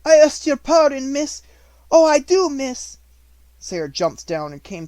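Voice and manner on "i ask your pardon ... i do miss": put-on high, loud, pleading servant girl; scared maid begging her young mistress, high and loud; frightened Becky. A narrator's reading. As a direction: beg for pardon in a panic. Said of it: "she stuttered".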